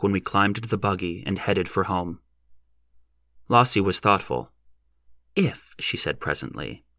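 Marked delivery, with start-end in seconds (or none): 5.4-5.7 put-on much higher girl's voice; young woman voice. A narrator's reading. Lossie voiced in story.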